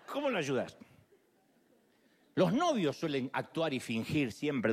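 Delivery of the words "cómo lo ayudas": normal talking voice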